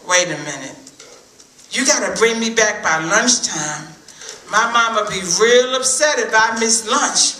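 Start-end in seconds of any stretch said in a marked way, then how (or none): none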